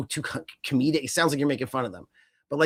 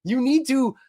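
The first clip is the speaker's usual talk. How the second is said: incredulous outburst; 10 semitones higher, 5 dB louder, slower than usual. Retold or imitated. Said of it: "I was all like".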